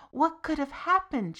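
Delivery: a touch of a mother's wail. A narrator's reading. Mother wailing to her son, mildly. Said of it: "she wailed".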